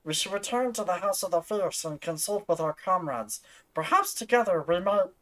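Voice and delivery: gremlin voice. Acted. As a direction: play it as a little gremlin. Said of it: "I'll do the Gremlin voice".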